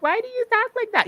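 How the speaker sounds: high-pitched mimicry of nosy people; mock questioning. Fed up with people asking about her speech, mock questioning. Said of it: "having people be like".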